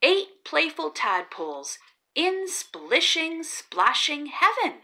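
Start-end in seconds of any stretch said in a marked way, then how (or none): none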